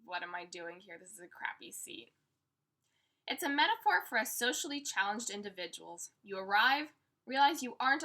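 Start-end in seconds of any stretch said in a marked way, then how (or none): 0.1-2.1 whispered. Retold or imitated. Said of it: "be like".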